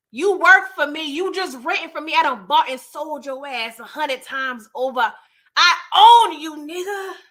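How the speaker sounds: acting out a domineering put-down; retold laying into him. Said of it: "you just laid it on him like that".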